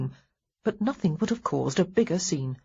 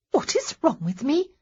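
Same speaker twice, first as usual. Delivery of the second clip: much higher, rising in disbelief; indignant echo; 10 semitones higher than usual. Acted. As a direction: throw the question back, affronted. Honoria throws question back.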